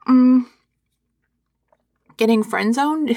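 chewing while talking; mouth full. Acted. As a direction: mumble through the banana. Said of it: "I'm supposed to talk with my mouth full".

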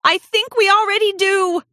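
high, uneasy admission; nervous gulp. A narrator's reading. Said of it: "she gulped".

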